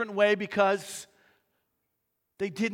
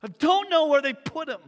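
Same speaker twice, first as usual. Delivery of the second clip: distressed plea; 5 semitones higher, 6 dB louder than usual. Acted. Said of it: "She responded the same way".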